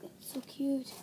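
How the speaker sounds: soft excited whisper. Real, not acted. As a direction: whisper it with delight. Whispering over the items.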